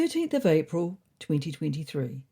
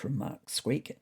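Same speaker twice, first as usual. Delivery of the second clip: ordinary delivery, nothing marked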